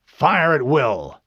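slow, loud, drawn-out command; captain's order. A narrator's reading. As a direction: give the order with weight. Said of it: "Captain Keyes ordered".

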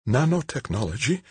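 high, astonished question; high-voiced woman aghast; shocked gasp. A narrator's reading. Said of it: "Angelique gasped".

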